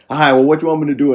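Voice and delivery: brusque retold challenge; dismissive retort. Hostile restaurant worker, acted.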